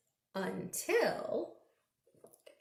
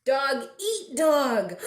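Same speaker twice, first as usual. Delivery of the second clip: slow, louder, fierce read; growly dog eat dog; 3 semitones higher, 6 dB louder, slower than usual. Acted. Reading a dog book to kids, slow and fierce. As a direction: chew each word like a snarl.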